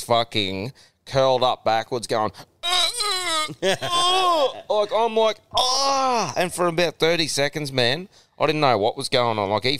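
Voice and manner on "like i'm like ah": a high, half-laughing groan of pain; pained cry. Retold or imitated. Dad curled up on the trampoline after his son's headbutt, yelping. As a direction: replay the shock of the headbutt with a high yelp.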